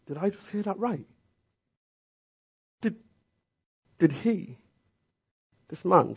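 disbelieving, pitch rising high; mock outrage at being told to fish again; incredulous. Preacher acting out his own disbelief at Jesus. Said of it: "I'm like".

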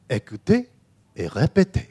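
formal taped French instruction voice; tape voice. Retold or imitated. Exam tape telling pupils to listen and repeat, announcer voice. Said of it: "this voice would come over the tape".

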